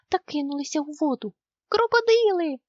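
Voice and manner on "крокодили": high, loud panicked yell; yelling in alarm. A narrator's reading. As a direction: scream out the warning. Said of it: "заволав Мумітроль".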